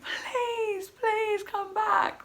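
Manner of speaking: high, drawn-out imploring wail; tearful pleading. Retold or imitated. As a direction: plead slowly, stretching each please. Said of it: "crying my eyes out all night".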